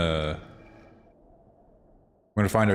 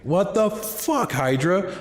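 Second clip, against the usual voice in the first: high, slow stretched outrage; outraged disbelief; 10 semitones higher, much slower than usual. Retold or imitated. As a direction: drag out the betrayed shock. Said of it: "And I'm like".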